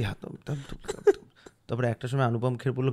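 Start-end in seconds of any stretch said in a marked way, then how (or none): 0.0-1.2 mock stammer. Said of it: "তুতলে তাতলে".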